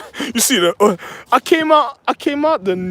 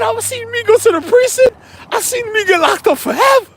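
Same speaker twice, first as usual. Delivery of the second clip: much higher, put-on panicky wife voice; wife's panicked voice; 4 dB louder than usual. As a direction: squeal it like a frightened wife. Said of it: "She said".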